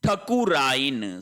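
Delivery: ठकुराइन said slowly, short ठ not ठा; correct form, slow. Shown as a demonstration. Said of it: "ठाकुराइन नहीं होगा". (ठकुराइन धीरे, छोटा ठ)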